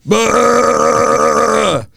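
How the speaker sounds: loud high retch; retching noise. Retold or imitated. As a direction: imitate him vomiting in a bin. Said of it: "He's just going".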